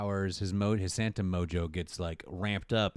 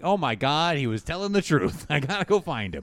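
louder, higher mock amazement; acted realization; 5 semitones higher, 5 dB louder than usual. Host as heroine realizing Santa was truthful, urgent mock amazement. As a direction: exclaim it as a sudden revelation.

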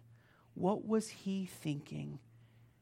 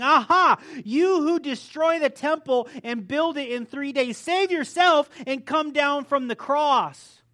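mocking taunt; 11 semitones higher, 16 dB louder than usual. Mockers quoted at the cross.

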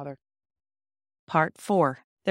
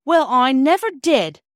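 much louder, higher and slow astonished exclaim; 8 semitones higher, 10 dB louder, slower than usual. A narrator's reading. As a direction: blurt it out in pure amazement. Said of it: "too surprised even to remember".

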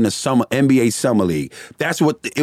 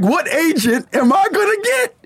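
laughing quote. Retold or imitated. Laughing while quoting himself.

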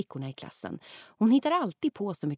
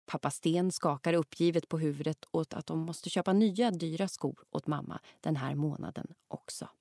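usual speaking voice

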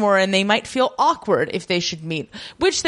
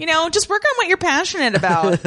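high, breezy startup pitch; mock startup voice; 7 semitones higher, faster than usual. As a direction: play a chirpy tech recruiter. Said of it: "They're like".

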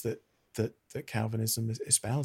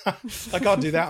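higher and louder, with a real laugh; laughing through tears; 8 semitones higher, 7 dB louder than usual. Real, not acted. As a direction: laugh off the rising emotion. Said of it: "I'm gonna get emotional".

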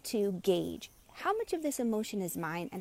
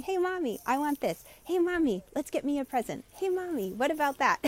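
put-on high, whiny little-girl voice; toddler asking mom; 6 semitones higher than usual. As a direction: beg for a toy like a toddler.

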